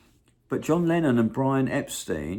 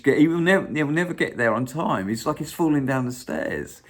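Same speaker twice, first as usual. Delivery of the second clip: ordinary delivery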